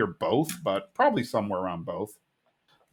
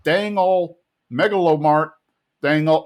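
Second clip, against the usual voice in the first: higher, louder cartoon-character impression; Boomhauer; 5 semitones higher, 5 dB louder than usual. Man calling nine one one, imitated character voice. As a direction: imitate his trademark talk. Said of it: "talking in his Boomhauer way".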